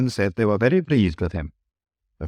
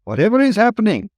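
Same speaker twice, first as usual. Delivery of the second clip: quick, high fluster; startled trucks crying out; alarmed trucks; 12 semitones higher, faster than usual. Acted.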